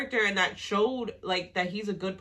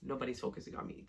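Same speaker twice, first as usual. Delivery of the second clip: softer sneaky scheming, falling to a whisper; 4 semitones lower, 8 dB quieter, faster than usual. Retold or imitated. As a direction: plot it under your breath. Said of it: "he was like".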